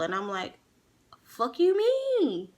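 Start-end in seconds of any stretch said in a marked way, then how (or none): 1.2-2.5 very high, drawn-out incredulous challenge; indignant retort. Retold or imitated. Girlfriend answering his threat to make her suffer, indignant. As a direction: squeal it back in stretched-out disbelief.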